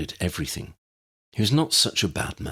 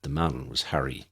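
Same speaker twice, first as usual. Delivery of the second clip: plain reading voice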